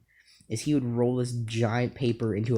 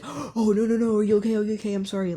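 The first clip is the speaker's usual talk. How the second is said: high, panicky mock apology; imitating a panicked friend; 9 semitones higher, 3 dB louder, faster than usual. Friend who hit him with a charger panics and apologizes, high and frantic. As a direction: play the friend freaking out after the accident. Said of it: "he's freaking out".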